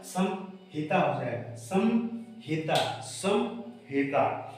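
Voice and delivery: slow, deliberate repetition; teacher dictating slowly; slow drilled answer. Shown as a demonstration. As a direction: stretch the word out slowly for students. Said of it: "teacher drills the answer slowly".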